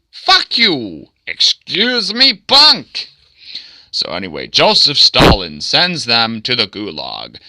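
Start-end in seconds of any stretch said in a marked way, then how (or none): 0.1-3.1 high, hammed-up indignant shouting; acted angry retort. Man talks back to Stalin over sharing bread, angry retort. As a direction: jump way up in pitch, louder on the last word. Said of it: "And then he says".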